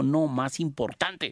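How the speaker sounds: high, drawn-out "más importante"; emphatic correction. Acted. Officer corrects boss's guesses.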